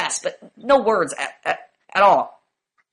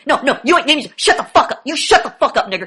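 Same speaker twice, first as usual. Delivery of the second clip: acted angry shutdown of someone talking over you; agitated outburst; 4 semitones higher, 4 dB louder than usual. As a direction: imitate someone suddenly pissed off, high and loud. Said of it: "you get agitated and you sound agitated".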